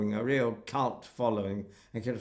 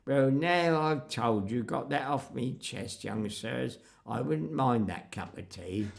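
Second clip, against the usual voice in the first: West Country countryman declining politely; imitated West Country speaker; West Country accent. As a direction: put on a rustic West Country burr. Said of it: "Afraid I'm using a West Country accent".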